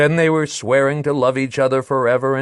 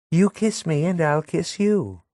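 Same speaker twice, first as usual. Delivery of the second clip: put-on lightly higher coaxing young woman; Madelon's voice; 7 semitones higher than usual. Madelon coaxing Léon, lightly higher playful voice. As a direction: coax him into a kiss.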